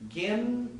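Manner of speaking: Gen said alone, high and clipped; high-pitched model syllable Gen; pronunciation demo. Shown as a demonstration. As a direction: isolate Gen as a sample syllable. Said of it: "See, just say it".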